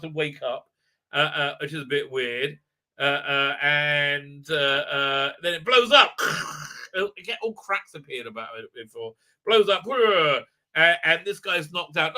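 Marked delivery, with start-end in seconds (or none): 6.2-6.9 breath-made blast noise; rasped whoosh without voice; imitated explosion. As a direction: mouth sound of something blowing up. Imitates the statue blowing up.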